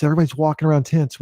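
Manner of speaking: normal talking voice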